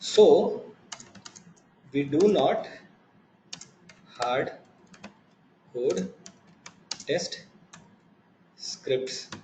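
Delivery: dictating notes word by word, slow; slow dictation. Acted. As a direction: spell it out slowly for someone writing along. Dictating notes while typing.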